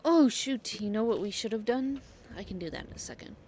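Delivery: ordinary delivery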